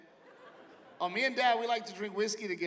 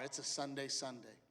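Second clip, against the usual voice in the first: lower, softer announcing voice; dad announcing; 4 semitones lower, 8 dB quieter than usual. Retold or imitated. Dad announcing the treat to the kids, low. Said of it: "my dad would announce to the car".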